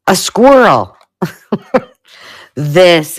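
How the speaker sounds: light up at the discovery; a happy little exclaim; delighted surprise. Real, not acted. Delighted discovery of squirrel ornament.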